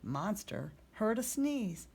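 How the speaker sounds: usual speaking voice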